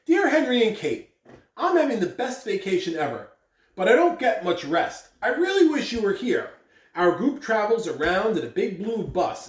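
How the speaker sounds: lightly voiced storybook letter; reading to children. Principal reading the grandfather's letter to the kids, softly storytelling. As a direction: read it gently to little listeners. Reads a picture book aloud.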